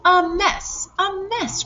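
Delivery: high alarmed echo; cried out. A narrator's reading. Second hen crying out at the mess, high. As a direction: wail it in dismay. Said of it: "cried the other".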